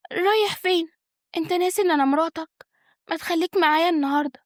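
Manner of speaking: high, pleading woman's voice; coaxing wife. Acted. Wife asks her departing husband to stay, coaxing. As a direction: coax him in a high, wifely voice.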